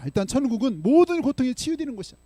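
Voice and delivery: high, loud proclamation; a preacher's cry that heaven heals all pain; full-force preaching. Acted. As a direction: proclaim it to the whole church.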